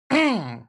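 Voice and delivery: rough cough to clear throat. Real, not acted. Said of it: "excuse me".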